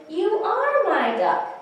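high, slow, loud exclamation; dawning realization. Acted. Alligator realizes who his duck is, delighted exclamation. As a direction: exclaim it slowly as it sinks in. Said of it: "And he realizes".

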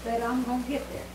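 weak, strained voice after surgery. Real, not acted. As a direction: speak through post-surgery weakness. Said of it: "I had surgery yesterday".

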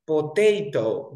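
slow Potato with ta stressed. Shown as a demonstration. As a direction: stretch Potato, punch ta. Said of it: "la palabra es así".

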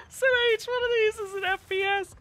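high laugh-cry voice; can barely talk for laughing; laughing while talking. Real, not acted. Laughing-crying while explaining.

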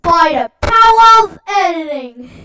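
loud, booming, dramatic proclamation; big announcer line. Retold or imitated. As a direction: declare it like a grand catchphrase. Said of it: "It's like".

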